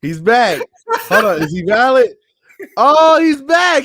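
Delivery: loud, rising excitement at a return; excited calling out. Real, not acted. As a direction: announce the comeback like big news. Host sees friend return to stream.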